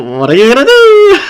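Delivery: loud, high, rising jeer; giddy, mocking question; gleeful taunt. Real, not acted. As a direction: tease the trapped opponent, voice soaring. Taunts the stuck opponent.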